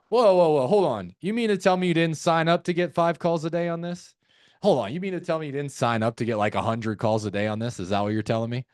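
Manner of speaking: mock disbelief, opening on animated whoas; playful tone. Shown as a demonstration. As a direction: tease them with feigned shock. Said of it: "that's our playful tone".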